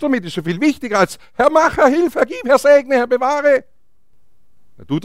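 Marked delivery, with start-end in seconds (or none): 1.3-3.6 high, insistent string of pleas; mock pleading prayer. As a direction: rattle off requests to God as parody. Parodies passive pleading prayer. (übertrieben flehendes Beten)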